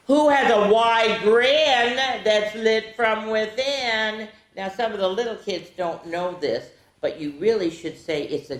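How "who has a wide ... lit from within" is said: spooky reading. Acted. Reading riddle to kids.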